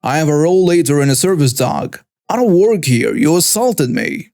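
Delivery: ordinary delivery, nothing marked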